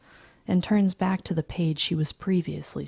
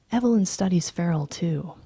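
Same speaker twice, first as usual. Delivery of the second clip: ordinary delivery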